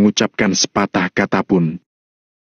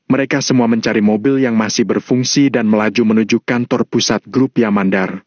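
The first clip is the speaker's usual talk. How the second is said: plain reading voice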